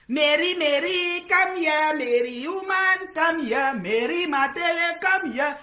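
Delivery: loud high sing-song calling of Mary; mocking call. Livestreamer mockingly calling Mary to come here. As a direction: call her over like a scolding mother.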